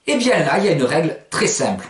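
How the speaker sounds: ordinary talk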